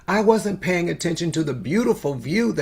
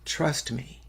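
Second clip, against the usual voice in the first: softer, gentle and hushed; tender reassurance; 2 semitones lower, 9 dB quieter than usual. Retold or imitated. Preacher recalls father's magic words, softly and gently. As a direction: say it like a promise. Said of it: "Those were the magic words".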